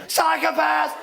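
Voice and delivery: high-pitched shouted accusation; sudden high yell; mock yell. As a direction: holler it like a passing cyclist. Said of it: "does the same thing".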